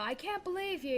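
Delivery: sulky retold accusation; pouting. Boy confronting the girl on the bus, pouting. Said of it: "and then I pout".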